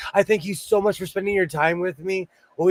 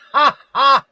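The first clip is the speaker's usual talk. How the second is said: big loud burst of laughter; 9 semitones higher, 7 dB louder than usual. Real, not acted. Laughing loudly at her own joke about Canadian accents. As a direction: belt out a ha ha laugh.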